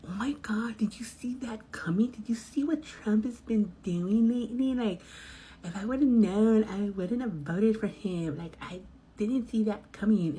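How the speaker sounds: imitating a tipsy passenger gushing. Rideshare driver mimics tipsy passengers, whispery and high. Drunk passengers chatting about Trump.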